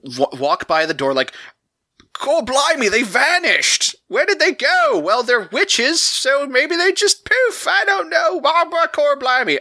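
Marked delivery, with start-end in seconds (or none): 2.1-9.6 high-pitched, hammed-up Australian-British soldier; Australian-British soldier impression. Baffled soldiers finding the witches gone, hammed-up Australian-British accent. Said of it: "incredibly Australian British soldiers".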